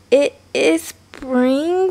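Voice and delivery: slow, hesitant, shaky doubt; shivering. Acted. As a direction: falter as the cold hits. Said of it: "suddenly shivering".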